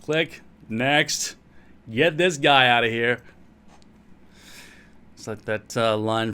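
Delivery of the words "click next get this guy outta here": put-on loud, dismissive American accent; hammed-up American accent; mock American viewers. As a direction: brush him off as an annoyed American. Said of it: "And they've gone".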